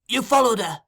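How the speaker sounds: high, loud leading question. A narrator's reading. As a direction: press for a yes.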